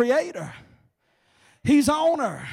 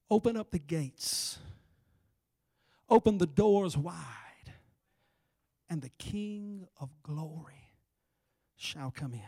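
solemn scripture recital; 4 semitones lower, slower than usual. Real, not acted. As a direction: let each phrase drop into a hush.

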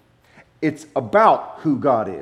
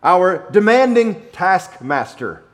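slow, loud, stern delivery; mock-grand dramatic wrong version; slow, weighty mock misquote; 6 semitones higher, 6 dB louder, slower than usual. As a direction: stretch out each word like a harsh boss.

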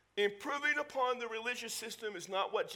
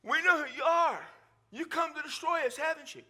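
loud, high mock demon shout; 6 semitones higher, 4 dB louder than usual. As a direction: cry out at Jesus like the possessed man. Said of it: "he just shouts out".

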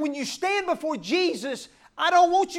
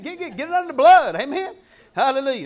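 normal talking voice

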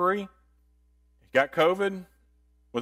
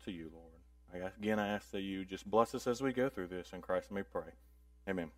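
soft, earnest opening prayer; 6 semitones lower, 7 dB quieter than usual. Acted. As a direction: pray quietly and sincerely. Opening prayer before class.

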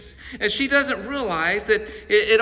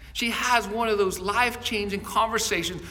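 ordinary talk

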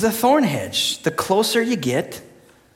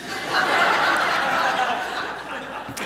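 whispered laugh; 5 semitones higher, 6 dB quieter than usual. Real, not acted.

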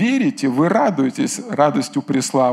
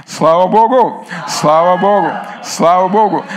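joyful shout; 3 semitones higher, 5 dB louder than usual. Real, not acted. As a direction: shout praise with a laugh. Congregational praise shout. (радостный возглас)

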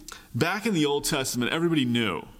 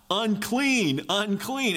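calling out a warning; 7 semitones higher, much slower than usual. Retold or imitated. Leper warns the crowd away with a high call. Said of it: "you have to yell out".

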